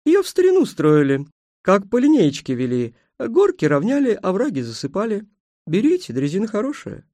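high, swooping countrywoman's voice; obliging station mistress. Acted. Station mistress recommends the old railway, high and obliging.

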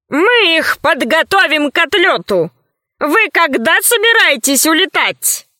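doctor's character voice. A narrator's reading. Doctor hopes Earth will find a cure, put-on character voice.

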